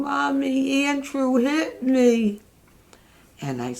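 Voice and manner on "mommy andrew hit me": put-on high, slow, whiny little-girl wail; little sister sobbing to mom on the phone; mock crying child. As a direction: imitate a tearful kid tattling to mom. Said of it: "She'd call me on the phone crying".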